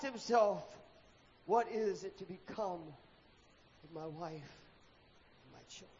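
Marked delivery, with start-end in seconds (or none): 1.5-6.0 quiet despair. A narrator's reading. Leper alone asks himself.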